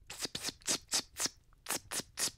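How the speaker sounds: whispered pss of a silencer; breathy pss gun sounds; silenced gunshots. Retold or imitated. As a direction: hiss out silenced shots. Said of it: "Toujours des silencieux sur mes fusillades".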